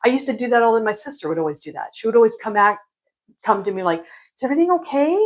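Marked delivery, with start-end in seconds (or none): 4.3-5.2 fake concern. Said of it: "acting like".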